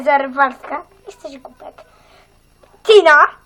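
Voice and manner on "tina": loud, sharp call of a name; stern teacher calling a pupil. Acted. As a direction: bark the pupil's name across the room.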